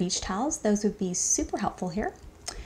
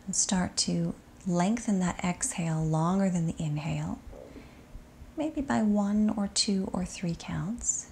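soft, unhurried body-scan voice with long settling pauses; guided meditation; 7 dB quieter than usual. Acted. Yoga teacher guiding students to sink into shavasana, soft meditation voice.

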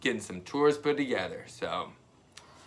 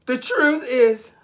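put-on girl's confession; 10 semitones higher, 9 dB louder than usual. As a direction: confess like a relieved child. Camilla confessing in story.

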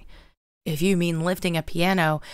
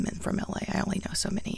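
whispered aside; a quick conspiratorial whisper; 2 semitones higher, 6 dB quieter, faster than usual. Real, not acted. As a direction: drop to a breathy whisper to the viewers.

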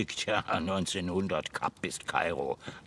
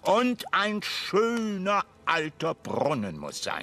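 enthusiastic demand; 12 semitones higher, 6 dB louder than usual. Acted. Major enthusing.

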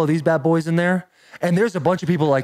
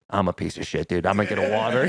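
low, soft and defeated; glum hungover mumble; 7 semitones lower, 3 dB quieter than usual. Retold or imitated. Shane tells his friend he feels awful, in a low defeated mumble. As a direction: sound like a man who feels like garbage. Shane slumped, hurting after drinking.

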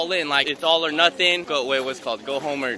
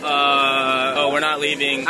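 mock awkward realization, pitched down from the storytelling; awkward standing around; slower than usual. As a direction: imitate an awkward, drawn-out uh. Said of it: "we were just awkwardly standing there like".

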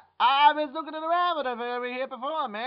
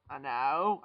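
ordinary talk

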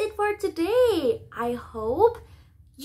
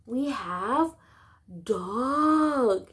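normal talking voice

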